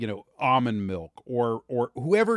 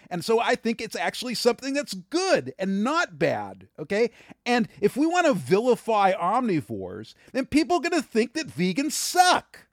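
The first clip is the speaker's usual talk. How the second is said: impassioned, high-pitched raised voice; worked-up pitch climbing to a near-yell; 6 semitones higher than usual. Real, not acted. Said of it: "Why are you yelling?".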